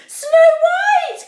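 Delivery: high, loud panicked call; cry of alarm. Acted. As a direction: shout her name in shock. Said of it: "cried Kitty".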